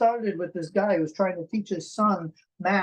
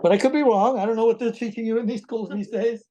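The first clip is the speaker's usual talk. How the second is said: higher, louder, in a put-on prim voice; mock scolding; 5 semitones higher, 4 dB louder than usual. Host teasing friends about aquarium lessons, mock scolding. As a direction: play a fussy old schoolmarm, tongue in cheek.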